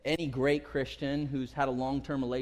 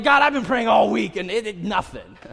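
frustrated prayer; 5 semitones higher, 9 dB louder than usual. Retold or imitated. Voicing a frustrated prayer to God.

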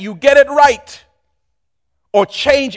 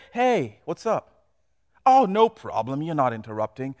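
sweet phone voice; 2 semitones lower, 6 dB quieter than usual. Acted. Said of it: "sweet voice on phone".

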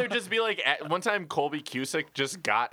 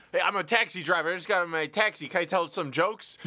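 taxi driver voice; 7 semitones higher than usual. Retold or imitated. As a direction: play a rambling cab driver. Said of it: "a guy who's like".

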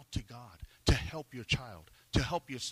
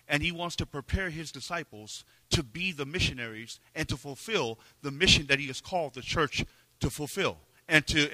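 ordinary delivery

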